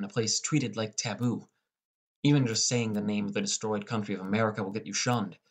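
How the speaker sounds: ordinary delivery, nothing marked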